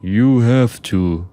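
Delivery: put-on slow Russian-accented insistence; thick Russian accent. A narrator's reading. Driver presses the narrator, thick Russian accent. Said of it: "said with a thick Russian accent".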